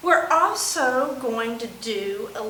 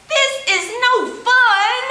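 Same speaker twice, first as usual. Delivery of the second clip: penguin voice; 9 semitones higher, 5 dB louder than usual. Acted. Penguin character complains in rhyme.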